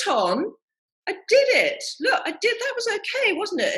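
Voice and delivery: high, eager squeal of pride; excited plea. Retold or imitated. To dance partner Anton, squealing high for approval.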